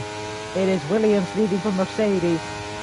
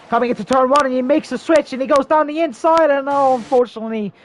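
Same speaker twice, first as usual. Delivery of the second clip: excited commentary; 8 semitones higher, 7 dB louder than usual. Real, not acted. Divebomb attempt, live call.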